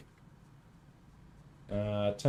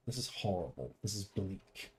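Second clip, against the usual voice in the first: ordinary delivery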